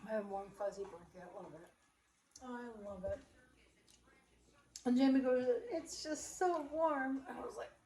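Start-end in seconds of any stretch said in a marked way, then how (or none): 5.7-7.2 very slow, high imitation of snug bliss; drawn-out teasing mimicry, pitched up; mock cosy gloating.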